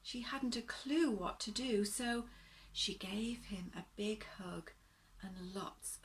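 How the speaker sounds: gentle bedtime story reading, rising and falling; storybook narration. A narrator's reading. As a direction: read it like a picture book to little ones.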